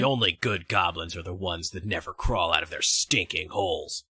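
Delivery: grim goblin hunter. Acted. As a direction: growl it low and quiet like a hardened monster slayer. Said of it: "That's pretty good".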